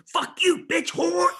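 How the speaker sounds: high, explosive mimicked shout; sudden retold shriek; imitated outburst. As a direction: blurt the insult in a high yell. Imitating second Tourette outburst.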